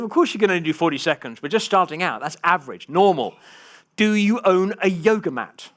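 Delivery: loud, high, emphatic reassurance; matter-of-fact tutor. Retold or imitated. Tutor answering his panicking pupil, emphatic and matter-of-fact. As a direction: brush the worry aside emphatically. Retold tutor's brisk reply.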